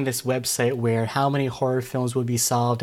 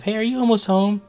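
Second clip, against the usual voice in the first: nervous check-in; 10 semitones higher, 7 dB louder than usual. Retold or imitated. Retold anxious text to wife.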